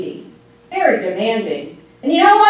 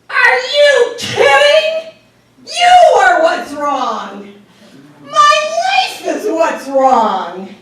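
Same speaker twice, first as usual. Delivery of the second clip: slow, loud, high furious retort; shouting each word slowly; angry outburst; 7 dB louder, much slower than usual. Retold or imitated. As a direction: let the anger boil over at her.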